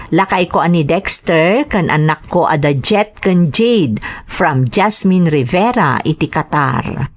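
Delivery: ordinary delivery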